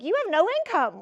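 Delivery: mocking banker. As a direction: sneer it like a dismissive banker. Bank laughing in her face.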